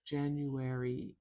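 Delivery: slow, careful January; slowed-down teaching repetition; slow demo. Shown as a demonstration. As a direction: say it slowly for the learner. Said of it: "E is the last sound there".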